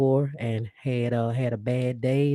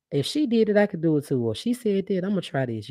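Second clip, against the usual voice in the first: higher, lightly voiced viewer's realization; imagined inspired viewer; 5 semitones higher, 3 dB louder than usual. Host imagining a viewer inspired by her, higher voice. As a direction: quote a heartened listener, pitched up.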